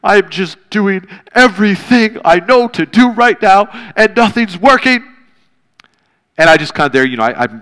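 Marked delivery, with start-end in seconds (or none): 0.0-5.0 emotional plea. Retold or imitated. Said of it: "I was really emotional".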